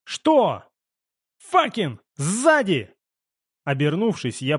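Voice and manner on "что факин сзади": raised, higher-pitched warning yell; urgent high call of alarm; alarmed cry. A narrator's reading. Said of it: "донёсся крик Блайта".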